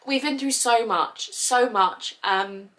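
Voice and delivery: ordinary delivery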